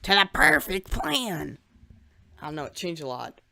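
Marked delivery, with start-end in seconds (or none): 0.0-1.6 slow, loud villain voice; drawn-out evil overlord line; Talon voice. Retold or imitated. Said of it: "Talon's voice just becomes from like this to like".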